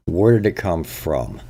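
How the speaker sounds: ordinary delivery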